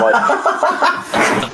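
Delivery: high burst of laughter mid-word; cracking up while trying to talk; laughing. Real, not acted. Telling a co-host a funny idea, laughing. Laughing at imagined pub scene.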